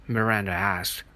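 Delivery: usual speaking voice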